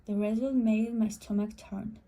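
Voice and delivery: plain reading voice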